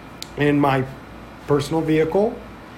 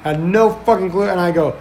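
normal talking voice

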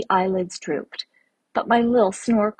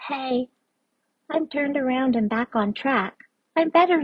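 little creature murmur; 6 semitones higher than usual. Acted. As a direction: murmur it like a small sleepy creature. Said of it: "and murmured".

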